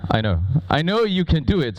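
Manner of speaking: ordinary talk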